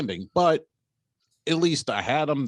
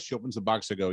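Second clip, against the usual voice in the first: ordinary delivery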